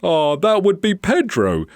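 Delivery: high, loud storybook answer; animated, projected explanation; shop owner explaining. Acted. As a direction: speak up over the bird. Owner answers over the noise.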